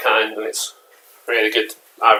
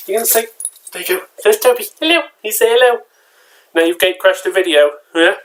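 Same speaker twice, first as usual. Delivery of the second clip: high, louder pet-talk voice; talking to the dog; 10 semitones higher, 4 dB louder than usual. Real, not acted. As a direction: coax the dog in a high sing-song.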